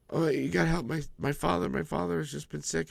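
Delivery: high, pleading mother's voice. Retold or imitated. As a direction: beg for help like a desperate mother. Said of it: "the mother and the son were like".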